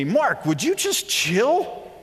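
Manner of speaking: high, slow, drawn-out "chill"; lightly voiced friend telling him to relax; friend calming him. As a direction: friend at lunch telling Mark to calm down. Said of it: "Alvin finally said to me".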